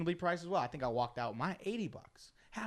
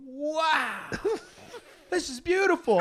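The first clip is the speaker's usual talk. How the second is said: higher, laughing wonder; mock awed friend; 12 semitones higher, 6 dB louder, slower than usual. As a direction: play the amazed friend.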